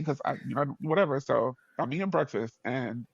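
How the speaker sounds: ordinary talk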